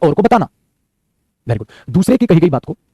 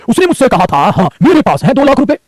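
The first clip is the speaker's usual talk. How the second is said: "उसने मुझसे कहा था", pause, then higher "मेरे पास है"; pause before the quote; 4 semitones higher, slower than usual. Shown as a demonstration. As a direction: break after "कहा था", lift the quote slowly. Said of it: "मेरा रुकना ही क्या होता है बेटा कोमा". ("कहा था" पर ठहराव, फिर "मेरे पास है")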